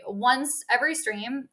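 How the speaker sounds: ordinary talk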